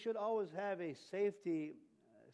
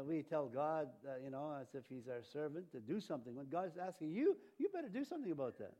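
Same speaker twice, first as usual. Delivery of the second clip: ordinary talk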